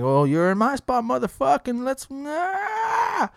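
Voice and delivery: hammed-up road-rage outburst that breaks off; mock angry yell.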